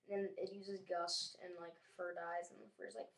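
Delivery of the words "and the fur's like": ordinary talk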